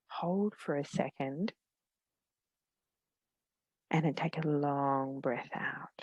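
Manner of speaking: gentle meditation guide, long pauses between phrases; unhurried, soothing guidance, letting each phrase settle; guided meditation. Real, not acted.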